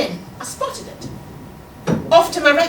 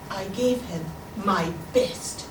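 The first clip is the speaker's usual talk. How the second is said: ordinary delivery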